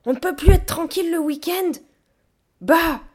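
high, loud exasperated yelling; hurlement. A narrator's reading. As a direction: yell back in exasperation. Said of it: "hurla-t-elle en retour". (hurler, exaspérée, d'une voix aiguë)

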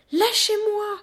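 high, loud yell to be released; screaming. A narrator's reading. Child struggling in the gorilla's grip, screaming. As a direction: scream to be let go. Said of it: "hurlai-je".

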